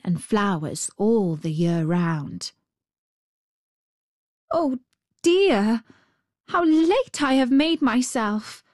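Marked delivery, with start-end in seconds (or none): 4.5-8.6 put-on high little girl's voice, dismayed; Gerda. A narrator's reading. Little girl scolds herself for lateness, high and dismayed. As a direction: lift it high like a young girl fretting. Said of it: "said Gerda".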